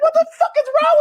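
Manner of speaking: high, loud, laughing outburst; mock shriek at a friend; laughing disbelief. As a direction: cry out in amused disbelief. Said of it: "I was like".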